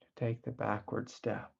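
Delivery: soft, unhurried meditation voice with long pauses; guided meditation. Acted. Teacher guiding listeners away from the thinking mind, soft guided meditation. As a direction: ease them gently into stillness, softly.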